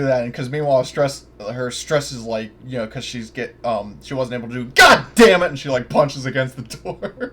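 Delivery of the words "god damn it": loud, high furious curse; angry outburst. Acted. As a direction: yell it at the locked door. Character fails, punches door.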